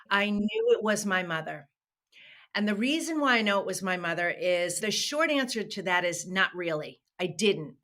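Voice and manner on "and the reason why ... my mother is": normal talking voice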